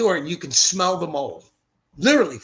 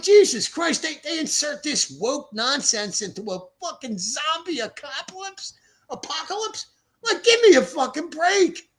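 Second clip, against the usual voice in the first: much higher, exasperated outburst at woke TV; incredulous rant; 11 semitones higher, 4 dB louder than usual. Retold or imitated. Retelling his outburst at Reese, high exasperated rant. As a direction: fume about it like it's the last straw.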